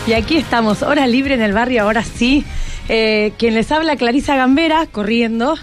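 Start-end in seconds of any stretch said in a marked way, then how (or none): none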